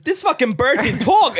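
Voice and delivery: high, slowed mock amazement; hammed-up astonished man; amazed boast. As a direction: show off an unbelievable find. Man amazed, tells Jesus.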